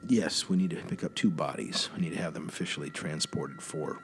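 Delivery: low, quiet official phone voice; formal agent on phone. Acted. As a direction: speak low like a by-the-book federal agent.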